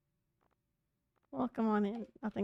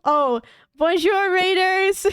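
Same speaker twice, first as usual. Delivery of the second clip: put-on loud, higher French-accented greeting; 5 semitones higher, 9 dB louder than usual. Streamer greets arriving raiders, playful loud French accent. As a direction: belt out a playful French bonjour.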